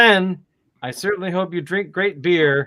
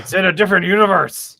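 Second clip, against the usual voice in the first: alarmed sci-fi officer, louder and higher; Star Trek crew member; 4 semitones higher, 7 dB louder than usual. Retold or imitated. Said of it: "it's like they're looking at something".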